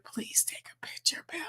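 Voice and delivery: hushed please; a whispered request to a friend. Real, not acted. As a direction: beg it softly. Pleading to absent friend.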